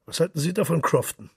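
high, loud eager question; loud calling out. A narrator's reading. Mister Henchy asks Crofton about the poem, calling out loudly. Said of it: "rief Mister Henchy".

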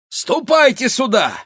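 very high, loud hail; high ringing summons; calling out. A narrator's reading. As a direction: holler to the lads across the street. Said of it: "кричал он, махая рукою".

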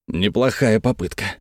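ordinary delivery, nothing marked

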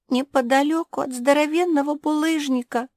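high-pitched character calling out; voice calling back. A narrator's reading. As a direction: call back in a high little voice. Unseen voice calls back from pit.

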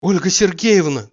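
loud desperate call after her. Acted. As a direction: shout her name as she walks away. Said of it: "крикнул он".

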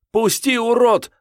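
very high, loud scream; shrieking at an attacker; screamed protest. A narrator's reading. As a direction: scream to be let go. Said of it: "закричала она".

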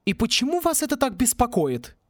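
high, probing female character voice; high-voiced suspicious questioning. A narrator's reading. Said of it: "подозрительно спросила мисс Сьюзен".

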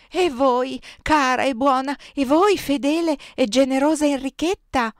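high, loud pleading question; anxious appeal. A narrator's reading. Superiora turning to faithful Enrichetta, anxious appeal. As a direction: appeal urgently to a trusted friend.

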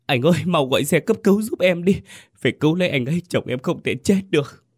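high, desperate cry for help; wailing plea. Acted. Young wife begs brother-in-law to save husband, wailing high. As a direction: beg him to call an ambulance, voice cracking high. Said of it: "cô gào lên".

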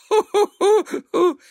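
high, loud hoo-hoo laugh; giggling. A narrator's reading. Sister poking her brother's ribs, giggling.

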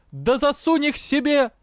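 high-pitched furious retort; enraged outburst. A narrator's reading. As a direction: fling it back, incensed. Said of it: "начал взбешённый Юр". (взбешённо, на высоких тонах)

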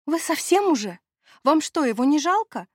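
high, loud indignant outburst. Acted. Sandy to her friends, shocked and outraged. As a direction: scold them, outraged. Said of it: "возмутилась я".

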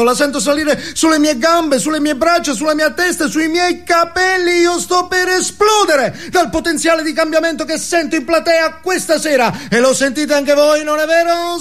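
high, excited stage preacher voice; motivational guru. Acted. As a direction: whip the crowd up like a self-help guru. (guru motivazionale esaltato, voce alta)

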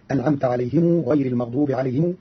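عليهم said عليهمو with the mim joined to a long u; Qalun's sila, عليهمو not عليهم. Shown as a demonstration. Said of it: "فله فيها صلة هكذا".

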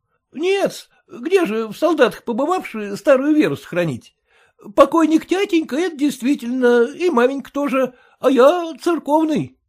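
bow and scrape to the master; humble clerk. A narrator's reading. Clerk's voice in story.

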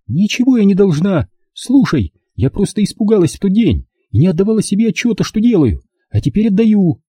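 high, insistent daughter's voice; defiant daughter. Acted. Daughter refusing to report to police, defiant.

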